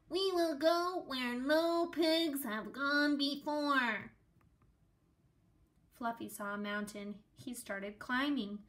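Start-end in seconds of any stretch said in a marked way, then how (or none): 0.1-4.1 slow, higher and louder heroic proclamation; grand explorer voice. A narrator's reading. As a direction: announce it like a bold captain. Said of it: "said Fluffy the Explorer".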